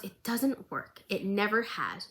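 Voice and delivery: slow, soft, hushed whisper of a wounded fighter; weak whisper. Retold or imitated. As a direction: breathe it out, barely holding on. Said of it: "And Vi goes".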